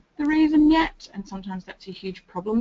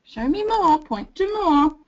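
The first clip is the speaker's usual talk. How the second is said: louder and higher, slow, pushing show me more; demanding prompt; 4 semitones higher, 5 dB louder, slower than usual. Shown as a demonstration. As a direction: press the child to perform. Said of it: "we are not saying".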